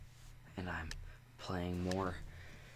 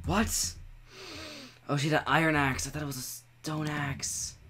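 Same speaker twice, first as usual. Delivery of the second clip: shocked; 7 semitones higher, 5 dB louder than usual. Real, not acted. Surprised by death.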